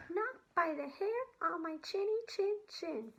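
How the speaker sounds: little pig refusing the wolf. Acted. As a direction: squeak it out like a small defiant pig.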